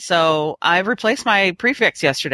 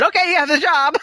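light, offhand high voice; casual boss voice; 9 semitones higher than usual. Retold or imitated. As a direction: toss it off casually. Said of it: "and he said".

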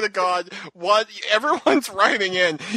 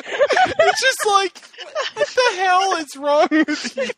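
laughing disbelief; 13 semitones higher, much slower than usual. Real, not acted. Exasperated reaction, laughing.

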